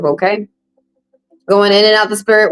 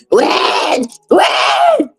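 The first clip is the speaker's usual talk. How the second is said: repeated whiny "When?", pitched much higher and rising; nagging client; 14 semitones higher, much slower than usual. Retold or imitated. Tarot reader mocking a client nagging spirits, high whiny. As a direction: pester the spirits like an impatient client. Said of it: "you keep saying to the spirit".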